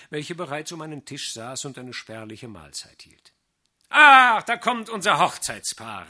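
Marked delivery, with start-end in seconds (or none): 3.9-6.1 loud, high, merry greeting; called out. A narrator's reading. Fiddler welcoming the wedding pair into the inn, loud merry call. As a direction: hail the couple across the room. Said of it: "rief der Geiger".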